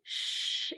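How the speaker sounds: put-on श as a breathy hush to a child. Shown as a demonstration. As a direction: shush like quieting a child. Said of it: "बच्चों को चुप कराते हुए".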